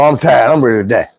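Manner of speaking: usual speaking voice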